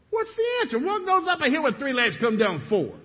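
very high, loud, strained squeal; desperate high plea. Retold or imitated. Businessman begging the old man, high desperate squeal. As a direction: voice cracking in frustration.

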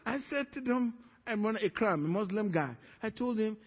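ordinary delivery

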